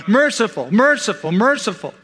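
high, evenly repeated chant-like acclamation; mock angelic cry. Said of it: "The angels don't cry".

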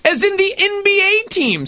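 high-pitched, hammed-up insistence; exasperated agent. Imitating an agent pressing his player, exasperated. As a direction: drive it home to someone who isn't getting it. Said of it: "his agent calling him and saying".

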